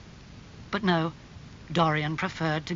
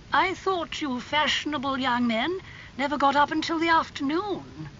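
lofty, high storybook aunt; surprised aristocratic aunt; 11 semitones higher than usual. A narrator's reading. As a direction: tease a nephew in a high aunt's voice.

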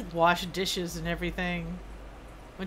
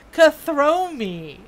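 loud, high, very slow name call; hammed-up slow holler, falling at the end; playful drawn-out call; 7 semitones higher, 14 dB louder, much slower than usual. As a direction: stretch the name out and belt it. Calling out misread name playfully.

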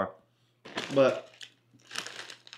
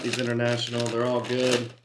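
ordinary delivery

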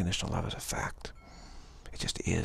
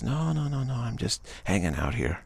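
high innocent cat; 4 semitones higher than usual. Retold or imitated. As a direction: play the cat feigning innocence. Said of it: "The cat says".

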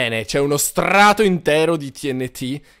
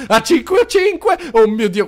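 rising excitement; 6 semitones higher, 5 dB louder than usual. Real, not acted. Cannon firing, rising excitement.

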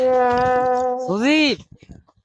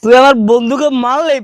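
put-on drunken defiance; 8 dB louder than usual. Drunk defies gunman.

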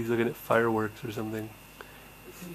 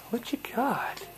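whisper; 10 semitones higher, 5 dB quieter than usual. Real, not acted. Whispering to the baby.

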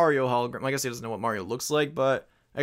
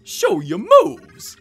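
mock announcer shout; 10 dB louder, much slower than usual. Player mocks game's dance prompt.

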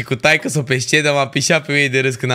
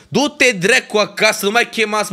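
furious outburst; 7 semitones higher than usual. Real, not acted. Streamer yelling at festival organisers through chat, angry. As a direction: throw it at the organisers. Rant about festival scheduling.

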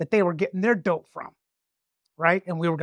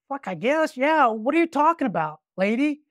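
baffled informant; 7 semitones higher than usual. Retold or imitated. Informant's baffled reply retold.

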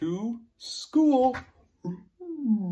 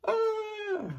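yell. Shown as a demonstration. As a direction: let out a big yell. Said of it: "This one is yell".

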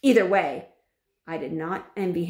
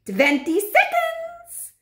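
very high, slow witch cry; 6 dB louder, much slower than usual. A narrator's reading. Grand High Witch counting down for the audience, crying out. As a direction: call the countdown high, loud and stretched. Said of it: "cried the Grand High Witch".

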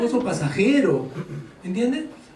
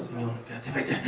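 much softer, lower, holding yourself back; low, soft plea to wait; quiet self-restraint; 10 semitones lower, 10 dB quieter, faster than usual. Acted. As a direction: hush yourself as if reining in anger. Voicing self-control prayer.

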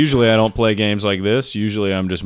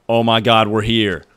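loud, rising into a high whoop; elated shout, voice climbing; excited triumph; 4 semitones higher, 8 dB louder, slower than usual. Real, not acted. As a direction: exclaim in disbelief, then holler. Reaching new spot in game.

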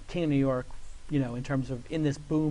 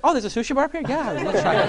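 higher, delighted offer, a laugh in it; mock Barry; 9 semitones higher, 7 dB louder than usual. Barry proudly offering sushi upstairs, high and delighted. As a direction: play the host showing off his sushi bar.

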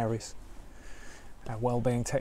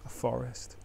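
guided meditation; 4 dB quieter than usual. Acted. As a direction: ease listeners into stillness gently.

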